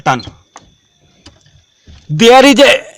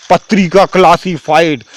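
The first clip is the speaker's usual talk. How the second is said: ordinary talk, nothing marked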